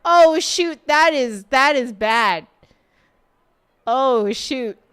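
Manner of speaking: loud, dismayed oh shoot; shocked outburst. Real, not acted. Streamer reacting to a spoiler, loud dismay. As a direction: blurt it out, caught off guard.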